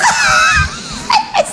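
very high, wailing laugh near tears; unhinged laugh. Acted. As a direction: laugh on the edge of a breakdown. Sleep-deprived sister cracks up.

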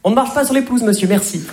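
high-pitched officious reprimand; mock-polite telling-off, coming down at the end; insincere politeness. Shown as a demonstration. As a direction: scold a stranger under cover of courtesy. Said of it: "qui voulaient pas du tout dire".

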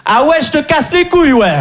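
high, laughing mock retort from across the flat; mocking girlfriend calling back from the other room; girlfriend's retort. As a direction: squeak it like the girlfriend overhearing. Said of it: "j'ai entendu".

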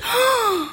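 soft, high breath of surprise; mock moan. Porn actress hearing the doorbell, mock moan. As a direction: let out a hammed-up little moan. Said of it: "actrice de film porno".

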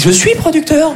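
mock protest. Friend indignantly insists, retold.

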